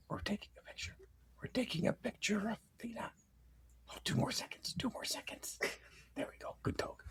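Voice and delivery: soft breathy whisper so the dog stays still; barely voiced, coaxing the dog for a photo. Real, not acted. Hushed while photographing the dog.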